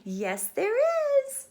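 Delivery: slow, high, loud sing-song; high loud slow yes; drawn-out insistence. Acted. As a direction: insist with glee. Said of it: "said Stephanie".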